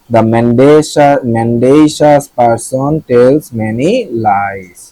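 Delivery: very slow, word by word, for learners; slow example sentence. Shown as a demonstration. Vocabulary teacher reading an example sentence slowly to students. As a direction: spell it out slowly for the class. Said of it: "reading example sentence slowly".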